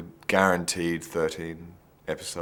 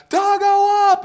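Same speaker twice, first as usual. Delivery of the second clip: loud, high yell of "Dargo up!"; yelled call; 12 dB louder, slower than usual. Retold or imitated. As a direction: yell "Dargo up!" like an assistant director on set. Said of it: "the second would yell out".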